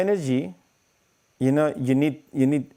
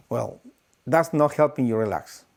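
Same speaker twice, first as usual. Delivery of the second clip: usual speaking voice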